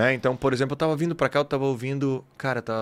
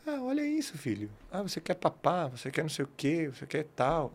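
much higher, soft mock baby talk, like a mother to her toddler; imitating a mother's baby talk; 5 semitones higher, 7 dB quieter than usual. Mother in the elevator cooing to her baby, softly imitated. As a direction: coo it lightly, like a mom to her baby. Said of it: "E a mãe ficava".

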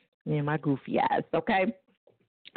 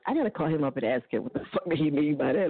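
ordinary talk, nothing marked

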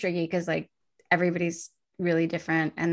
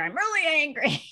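high-pitched, whiny show of anger; mock anger; 8 semitones higher than usual. Person everyone avoids announcing their anger, mock angry. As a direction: imitate exaggerated anger, pitched way up. Mocks people who act angry.

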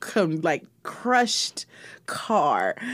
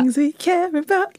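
put-on playful British accent, higher; 8 semitones higher than usual. Co-host opens the show in a put-on British accent. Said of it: "most famous British accent".

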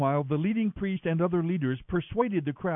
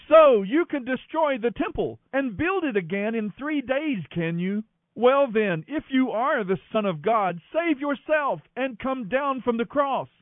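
raised-pitch jeering; mocking abuse; 6 semitones higher than usual. A narrator's reading. Passers-by taunt the crucified man, mocking. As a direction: jeer at him with a raised voice. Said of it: "shouted abuse, shaking their heads in mockery".

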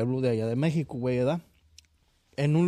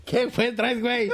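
laughing tease; 9 semitones higher, 4 dB louder than usual. Retold or imitated. Narrator teasing in story.

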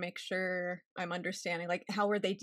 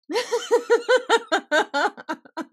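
loud high burst of laughter; big laugh; 13 semitones higher, 10 dB louder than usual. Real, not acted. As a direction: laugh hard at your own joke. Laughs at own joke.

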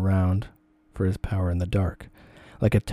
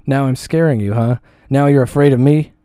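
loud taunting yell, pitched higher; shouting; 5 semitones higher, 6 dB louder than usual. A narrator's reading. Harasser following her to the car, shouting taunts. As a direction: jeer it at her, chasing.

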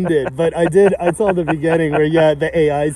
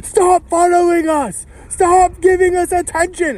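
high, drawn-out mock yell; 12 semitones higher, slower than usual. South Park royals parody demanding privacy, mock yelling. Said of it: "they're, like, yelling everywhere".

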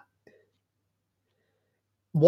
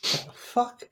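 whispered; 9 semitones higher, 8 dB quieter than usual. Real, not acted. Reacting to the odd noise.

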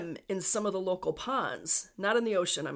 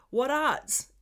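raised, louder amateur Newfoundland accent; Newfie accent; 6 semitones higher, 5 dB louder than usual. Shown as a demonstration. Podcaster repeating a phrase before translating it, attempted Newfie accent. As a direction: toss it out in mock Newfie. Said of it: "trying to do a Newfie accent".

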